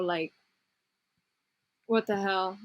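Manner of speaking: ordinary talk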